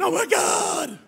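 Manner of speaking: high, loud hammed-up scream of pain; over-the-top fake agony yell; mock screaming. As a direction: wail it like a kid who's been paddled. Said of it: "started screaming".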